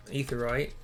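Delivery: ordinary delivery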